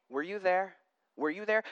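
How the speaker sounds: quiet, understated sarcasm; sarcastic God. Acted. God asking Job where he was, softly sarcastic. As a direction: needle softly with a rhetorical question. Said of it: "the sarcasm is just dripping".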